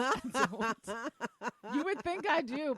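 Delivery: laughing, high and loud. Real, not acted. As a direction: laugh through the words. Laughing while insisting.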